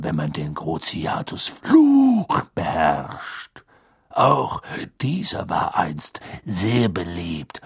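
low, slow growling lecture; grim Auror teacher. A narrator's reading. Teacher telling pupils about a torture curse, low menacing growl. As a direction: growl it slowly, dragging out key words.

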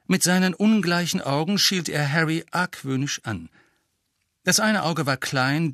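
plain reading voice

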